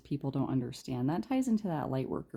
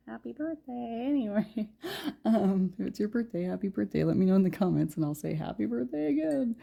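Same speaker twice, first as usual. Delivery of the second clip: cheerful birthday wish; 7 semitones higher than usual. Real, not acted. Birthday wishes to viewers.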